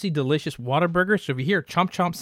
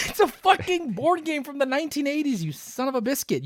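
mock outrage; 10 semitones higher than usual. Real, not acted.

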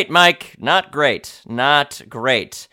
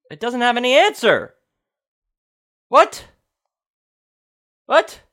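high-pitched, incredulous; squeaky, baffled questioning; baffled disbelief; 8 semitones higher than usual. Real, not acted. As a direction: let the pitch leap up in bafflement. Baffled by missing answer on page.

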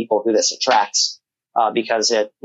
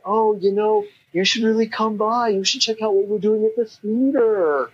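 high, far-off put-on voice, pitched way up; Zombie Joe impression; 10 semitones higher than usual. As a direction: play the eccentric theater guy, high and odd. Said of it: "That is such a good Zombie Joe impression".

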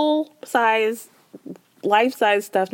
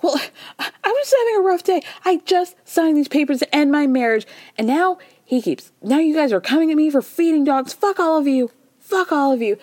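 higher-pitched imitation of a fed-up outburst; mock indignant rant; 7 semitones higher than usual. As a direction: act out her exasperated venting, pitched up. Acted outburst of fed-up cast member.

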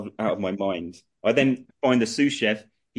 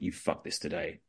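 quiet cold threat; 6 semitones lower, 11 dB quieter than usual. Retold or imitated. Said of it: "She, she went".

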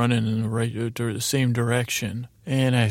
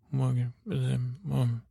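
slow, softer garbled mumble; Mumble Bear; 5 dB quieter, much slower than usual. Acted. Bear responds to Judy's name, mumbling. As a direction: mumble it softly and slowly. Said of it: "I don't got the mumble".

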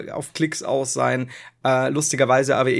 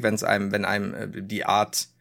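usual speaking voice

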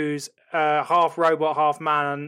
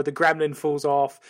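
usual speaking voice

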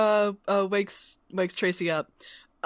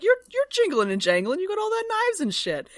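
indignant retort; 11 semitones higher, 3 dB louder than usual. Retold or imitated. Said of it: "she's like".

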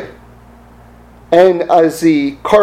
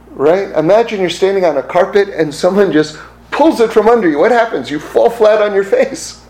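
ordinary delivery

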